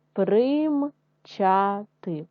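примчати drawn out slowly in syllables; slow syllable reading. Shown as a demonstration. Teacher sounding out примчати for first graders, slow. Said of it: "читаємо з вами слова".

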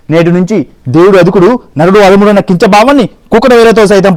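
loud, rolling mythological-film declamation; grand film dialogue. Acted. The human, defending humankind to Yama, declaims loudly like a film hero. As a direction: thunder it like a classic Telugu screen hero. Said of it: "నేను dialogue చెప్తా".

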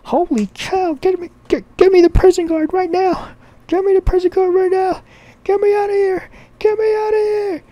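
very high, loud panicked shriek; freaking out. Acted. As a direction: beg frantically in a squealing falsetto. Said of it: "starts freaking out".

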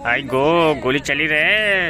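mock alarm cry, high and loud; Shreeman Legend. Gamer mimicking the voice pack's gunfire warning, mock alarm. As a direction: mimic a voice-pack shout, drawn out. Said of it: "Shriman legend किधर गए".

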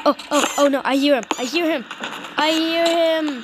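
rising alarm, repeated with growing urgency. Real, not acted. Player hears the enemy coming, alarmed.

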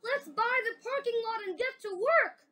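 rally everyone to start; eager cry. Acted. Miss Severs urges them to buy the lot, eagerly. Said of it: "cried Miss Severs".

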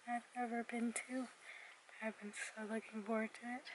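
soft late-night whisper. Real, not acted. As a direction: keep it hushed, others are sleeping.

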